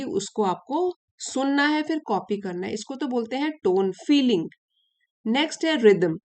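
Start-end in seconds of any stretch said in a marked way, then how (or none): none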